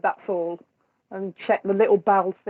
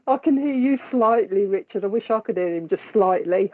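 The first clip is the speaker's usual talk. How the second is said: usual speaking voice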